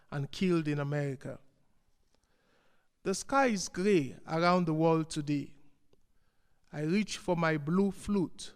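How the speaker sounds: solemn verse reading with weighted pauses; poem recital. Acted. Poet mourning the world's sorrows before an audience, measured recital. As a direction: recite as a lament, phrase by phrase.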